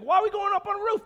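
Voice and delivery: high, loud, rushed mock panic; scared paralyzed man. Paralyzed man to friends hauling him roofward, panicked. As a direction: blurt it out in comic alarm. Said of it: "This guy must be so scared".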